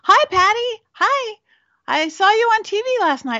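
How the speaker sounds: high, sing-song imitation of a friendly client; imitating the client. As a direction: chirp it up like an excited caller. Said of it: "my biggest corporate client called me up".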